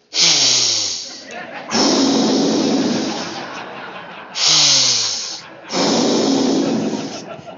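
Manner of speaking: a long breathy fit of laughter; long airy laugh. Real, not acted. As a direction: let the laugh run out. Laughing at daughter's reaction.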